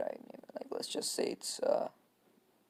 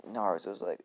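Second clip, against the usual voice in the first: usual speaking voice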